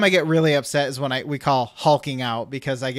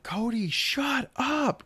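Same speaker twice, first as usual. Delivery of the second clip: high, slow, strained scolding; drawn-out mock scolding, softer than a yell; retold irritation; 10 semitones higher, 5 dB quieter, much slower than usual. Half-awake owner scolding the barking dog, irritated. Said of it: "So I'm really pissed off".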